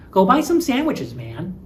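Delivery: high, insistent Go buy some sandwiches man; pushy urging. Shown as a demonstration. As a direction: prod a lazy friend into going. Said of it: "you were probably just sitting around".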